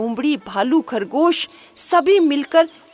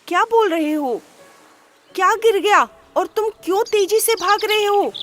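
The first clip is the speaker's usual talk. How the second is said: higher, quizzical bear voice; puzzled bear; 7 semitones higher, faster than usual. A narrator's reading. Bear asking the fleeing rabbit, puzzled. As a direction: ask the running rabbit what fell. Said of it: "भालू ने पूछा".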